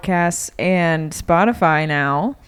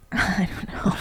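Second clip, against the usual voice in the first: whispered laugh; 5 semitones higher, 7 dB quieter than usual. Real, not acted.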